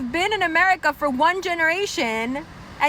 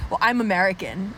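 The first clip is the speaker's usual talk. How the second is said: Indian American; 8 semitones lower than usual. Retold or imitated. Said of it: "I met an Indian person who was".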